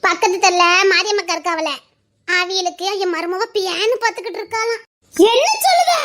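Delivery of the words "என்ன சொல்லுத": high, loud shouted question; calling out. Acted. Villager calling back to a neighbour, shouted question. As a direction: shout it across the street.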